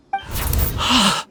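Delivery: breathy, high, voiceless gasp; a shocked intake of breath; sharp gasp. Acted. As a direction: gasp as the vision hits. Kunjun overwhelmed by the vision.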